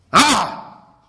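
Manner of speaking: loud, high cry of a possessed man; demoniac's outcry. Acted. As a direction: cry out in protest, drawing out the question. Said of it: "con la voz, de leer, con la entonación".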